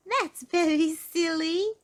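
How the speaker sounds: high, loud, slow squeaky giggle; tiny mouse giggling at Mister Silly. A narrator's reading. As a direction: giggle it out delightedly. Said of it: "giggled little Miss Tiny".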